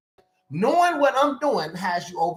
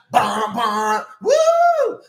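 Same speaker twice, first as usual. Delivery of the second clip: imitated band and whoop; 2 semitones higher, 7 dB louder, much slower than usual. Preacher imitating church band and crowd, loud whoop. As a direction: mimic the drums, then whoop. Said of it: "And he's like".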